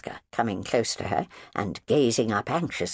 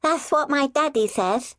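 put-on child announcing; 3 dB louder than usual. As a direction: pronounce it solemnly like a small child. Child quoting her father.

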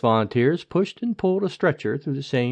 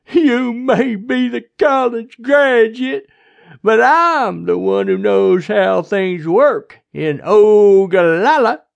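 loud, high, slow drawl; overbearing sheriff, boastful; 8 semitones higher, 8 dB louder, slower than usual. A narrator's reading. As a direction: lord it over her loudly.

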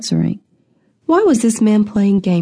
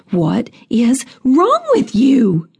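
slow, higher, every word separated; each word drawn out; 4 semitones higher, slower than usual. A narrator's reading. Grieving woman rebuking the questioning constable, each word drawn out. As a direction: stretch out each word in indignation. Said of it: "She drew out each word".

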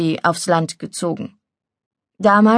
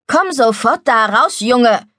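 raised, higher-pitched command; cross, insistent calling; scolding call; 4 semitones higher, 4 dB louder than usual. Acted. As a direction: order the boy out of the water. Scolding call to boy in pond.

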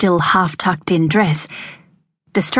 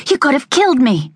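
angry; 8 semitones higher than usual. A narrator's reading. Veronica furious after near crash.

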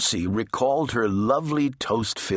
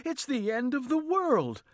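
alarmed wail; 5 dB quieter than usual. A narrator's reading. Wife wailing that it is the end, alarmed. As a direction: wail it high, softer than a shout.

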